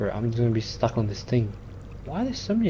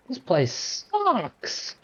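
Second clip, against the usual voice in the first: high, slow drawn-out whine; whiny complaint; 8 semitones higher, 3 dB quieter, much slower than usual. Real, not acted. Gamer complaining about the level, high slow whine.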